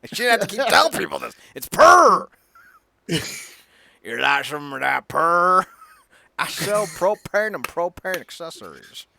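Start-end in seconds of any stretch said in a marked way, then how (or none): 1.5-2.3 "Purr" high, long and loud; drawn-out Purr. Shown as a demonstration. Hammering the podcast name at the cohost, loud stretched "Purr". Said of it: "Pronounce it right or get outta here". 4.0-9.1 slow Texan drawl of a propane salesman; a rough Hank Hill voice, drawled; Hank Hill impression. Quoting King of the Hill to his cohost in a Texan drawl. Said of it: "watching way too much fucking King of the Hill".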